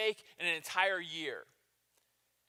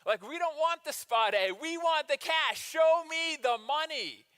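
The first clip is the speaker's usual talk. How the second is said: higher and louder, pushy complaint; indignant disciples; 7 semitones higher, 6 dB louder than usual. Retold or imitated. Disciples protesting to Jesus, pushy and loud. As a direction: whine like greedy followers. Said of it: "they, they go".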